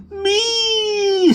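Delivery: very high, loud meow; a girl imitating the cat's yowl; mock cat meow. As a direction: squeal it like the cat.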